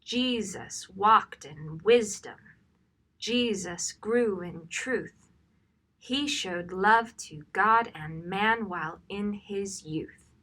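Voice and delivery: "Jesus walked in wisdom" read slowly with "Jesus" and "walked" punched, beat by beat; stressed rhythm reading. Shown as a demonstration. Trainer shows translators the hymn's rhythm, slow emphatic stressed reading. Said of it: "So it sounds like this when you read it".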